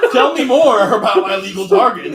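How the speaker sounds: laughing, high and loud; cracking up while talking. Real, not acted. Laughing at judge joke.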